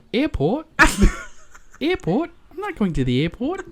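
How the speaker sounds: put-on high, giggly child voice; Bingo's cute little voice; little kid voice. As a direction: squeak it like a small Australian kid. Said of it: "the way she says".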